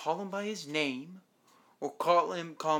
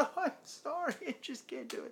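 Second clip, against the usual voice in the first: high, softer put-on weepy voice; mock tearful apology; 12 semitones higher, 6 dB quieter than usual. Grieving woman apologizing to Larry, mock tearful. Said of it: "She's grieving and crying".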